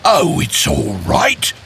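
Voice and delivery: calm the guests down gently; comforting bear. Acted. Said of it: "comforting his guests".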